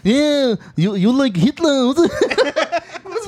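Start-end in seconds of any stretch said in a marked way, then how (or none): 0.0-1.9 high, hammed-up comic Israeli accent; botched German turned thick Israeli accent; Zohan. Imitating a friend's failed German accent, comic Israeli accent. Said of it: "turned into fucking Zohan".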